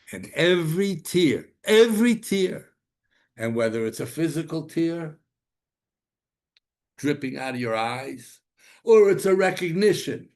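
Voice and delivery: every tear cried out high and loud; fervent preaching. Acted. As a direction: pound home every tear. Preaching at full force.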